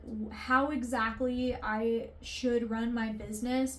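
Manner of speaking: normal talking voice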